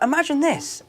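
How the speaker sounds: lightly voiced director giving an order; imitating the filmmakers. Filmmakers telling an actor what to picture, imitated instruction.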